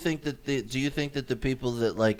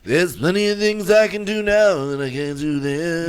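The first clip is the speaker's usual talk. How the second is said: a louder put-on old rocker voice; 5 semitones higher, 7 dB louder than usual. Echoing [name]'s quote back, mock old rocker voice.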